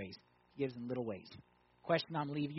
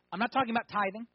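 usual speaking voice

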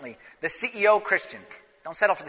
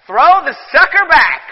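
booming, slow shout, sucker stressed; loud command; 6 semitones higher, 13 dB louder, slower than usual. Real, not acted. Preacher to the women in church, a slow booming shout. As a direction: throw it out there like a rallying cry.

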